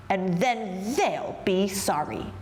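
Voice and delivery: slow, measured threat; brooding anger. A narrator's reading. Big Anthony plots to prove the town wrong, angry. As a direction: stretch it out, vowing payback. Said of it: "Big Anthony was angry".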